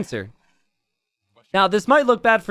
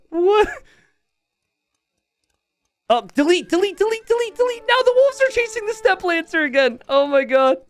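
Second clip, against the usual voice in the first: high-pitched frantic calls; excited shouting. Real, not acted. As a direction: urge him frantically. Caster screaming delete.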